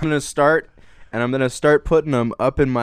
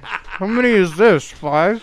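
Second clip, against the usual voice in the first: laughing through a full mouth; muffled words round marshmallows; cheeks stuffed full; 6 semitones higher, slower than usual. Real, not acted.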